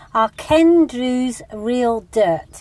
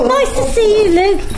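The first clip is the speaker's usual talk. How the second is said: bright greeting; 10 semitones higher, 7 dB louder than usual. Real, not acted. Greeting a friend at the door.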